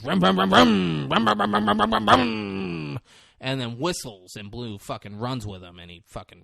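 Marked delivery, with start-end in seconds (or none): 0.0-3.0 higher, louder revving "rum rum" motorbike noise, slowing into a shout; mock engine revving. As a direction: rev it like a dirt bike, ending in a shout. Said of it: "He goes".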